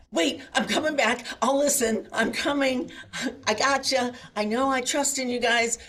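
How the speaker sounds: high, loud, hurried begging to come back; mock frantic plea. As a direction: play it like a panicked kid pleading.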